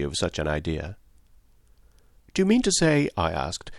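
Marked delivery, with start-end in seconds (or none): 2.3-3.1 high, tentative question; dawning realisation. A narrator's reading. Friend grasping that the doctor is a spy, incredulous. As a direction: ask as if the idea is only sinking in. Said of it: "slowly adapting myself to the new idea".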